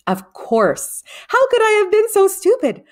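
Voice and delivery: loud and high, bursting with discovery; excited realization. A narrator's reading. Boy suddenly realizes the truth, loud excited discovery. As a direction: let the answer burst out of you.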